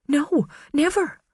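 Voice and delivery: high, pleading woman's voice; earnest, anxious defence of her husband; Karen's voice. Acted. Karen defending Garrett on the stand, high and pleading.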